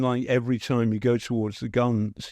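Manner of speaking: usual speaking voice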